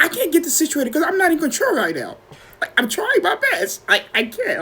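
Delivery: high-pitched helpless pleading; retold stressed panic. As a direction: plead it high, losing control. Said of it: "now I'm stressed".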